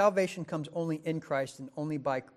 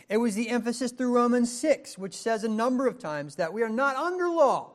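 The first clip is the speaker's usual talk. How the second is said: loud, higher, proclaiming the quote; preaching at full force; 6 semitones higher, 9 dB louder than usual. Acted. Preacher proclaiming Paul's words on the free gift, full force. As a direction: declare it like scripture from the pulpit.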